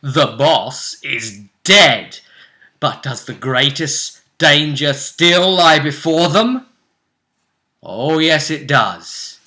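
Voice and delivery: slow, loud episode announcer; dramatic show intro. Acted.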